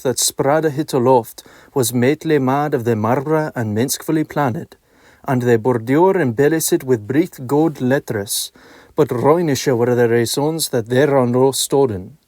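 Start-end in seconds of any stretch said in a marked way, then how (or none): none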